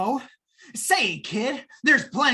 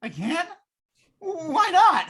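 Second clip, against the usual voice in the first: surprised; 8 semitones higher, 3 dB louder, much slower than usual. Acted. Told he can't bring logic.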